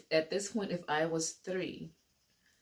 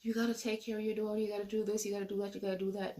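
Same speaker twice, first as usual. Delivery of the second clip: retold nagging yell; 4 semitones higher, much faster than usual. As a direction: rattle off demands, imitating a yelling mother. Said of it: "she was yelling at him".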